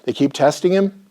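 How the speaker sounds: ordinary delivery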